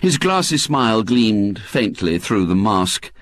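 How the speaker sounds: plain reading voice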